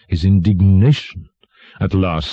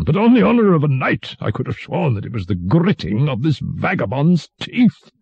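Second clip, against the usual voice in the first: high-pitched royal swearing; relieved, blustering king; 9 semitones higher than usual. A narrator's reading. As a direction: insist on your knightly honour. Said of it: "as if much relieved by the suggestion".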